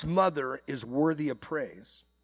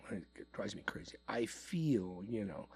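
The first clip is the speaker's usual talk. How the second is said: whisper; 7 semitones lower, 13 dB quieter than usual. Real, not acted. Aside about a hated buzzword.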